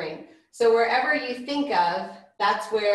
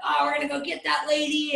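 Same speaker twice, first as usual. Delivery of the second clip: high-pitched worked-up threat; mock upset; 7 semitones higher than usual. Couple fired up against the chasing lady, mock upset. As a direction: imitate riled-up people vowing revenge. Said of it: "We were so upset".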